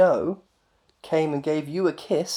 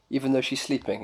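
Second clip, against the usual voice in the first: ordinary talk, nothing marked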